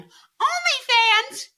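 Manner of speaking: very high, loud, drawn-out holler; silly high shout. Pretend. Host riffs on fans of that band, silly high shout. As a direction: squeal it out long and loud.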